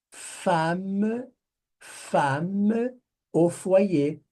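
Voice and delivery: femme au foyer drawn out very slowly, femme stressed with an open a; slow demo. Shown as a demonstration. Teacher pronouncing the French phrase for students, very slowly. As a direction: model femme au foyer at a crawl. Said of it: "que é femme".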